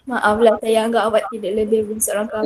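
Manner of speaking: ordinary talk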